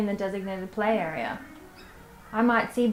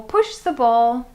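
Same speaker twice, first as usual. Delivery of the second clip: slow, louder encouraging cue; teaching cue; 2 semitones higher, 6 dB louder, slower than usual. Shown as a demonstration. Educator urging toddler Briar to push the ball, slow and louder. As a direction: stretch it out to urge a toddler on. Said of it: "my teaching cues might be".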